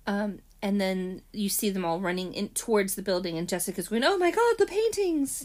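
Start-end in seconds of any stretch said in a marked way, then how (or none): 4.0-5.4 high, loud hammed-up alarm; mock panic. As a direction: play a horrified cry, pitched way up. Acted panic at burning paintings.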